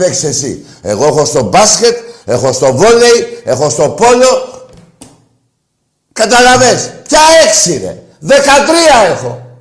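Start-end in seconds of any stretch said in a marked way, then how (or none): 6.1-9.5 yells it at the caller, pitch up and louder; angry shout. Real, not acted. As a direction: shout him down over the number. Angry host yelling at caller. (θυμωμένη φωνή, δυνατά)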